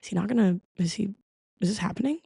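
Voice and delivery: a quiet, stunned crowd asking each other; confused crowd. Retold or imitated. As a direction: mutter it in disbelief to yourself. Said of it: "everybody was looking around like".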